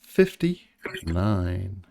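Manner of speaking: very slow, stretched number call; bingo caller. Pretend. Echoing drawn number bingo-style.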